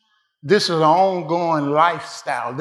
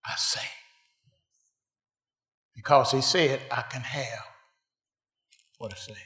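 soft intimate preaching; 7 dB quieter than usual. Real, not acted.

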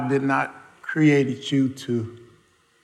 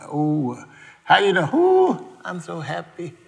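mock happy believer; 4 semitones higher than usual. Mocking a carefree believer.

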